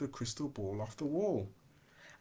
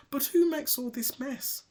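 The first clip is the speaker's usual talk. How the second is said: high surprised question; 6 dB louder than usual. Acted. Snowy asks.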